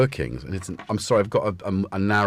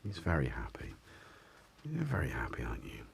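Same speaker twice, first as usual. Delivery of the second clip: hushed whisper over a sleepy cat; 8 semitones lower, 12 dB quieter than usual. Real, not acted. Owner whispers about the happy cat beside him. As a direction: whisper so as not to disturb him.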